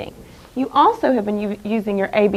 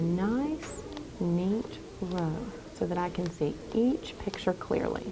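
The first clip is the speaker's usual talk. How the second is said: whispering; 10 dB quieter, slower than usual. Real, not acted. Teacher whispers mid-demo.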